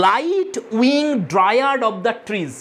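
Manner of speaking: each word drawn out very slowly; slow poem reading. Shown as a demonstration. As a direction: read the line slowly, as a recited verse. Teacher recites poem line. (খুব ধীরে কবিতার line পাঠ)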